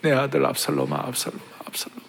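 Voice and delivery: fading into a breathless whisper; grieving father whispering his son's name; whispered lament. Acted. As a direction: let the cry die to a whisper. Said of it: "그렇게 울면서 통곡하면서".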